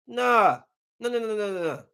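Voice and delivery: ordinary talk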